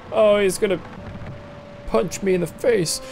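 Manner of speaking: ordinary delivery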